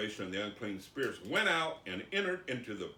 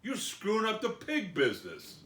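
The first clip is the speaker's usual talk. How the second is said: loud, high mock outrage; hammed-up indignant shout; angry townspeople; 10 semitones higher, 7 dB louder than usual. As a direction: order him out like an aggrieved pig farmer. Townspeople angrily sending Jesus away.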